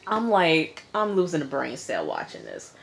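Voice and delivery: ordinary delivery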